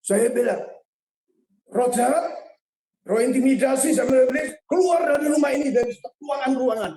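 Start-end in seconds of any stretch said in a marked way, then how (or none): 1.7-6.9 loud, high shout casting out spirits; shouted rebuke. Retold or imitated. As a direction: roar a command at the evil spirit. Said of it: "Saya langsung teriak". (teriakan lantang mengusir roh jahat)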